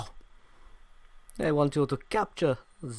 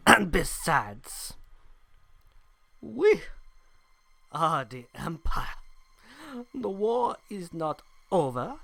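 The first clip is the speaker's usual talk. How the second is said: German villain; 4 semitones higher than usual. Acted. Empire officer menaces.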